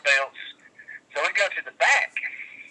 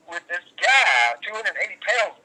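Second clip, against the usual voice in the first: usual speaking voice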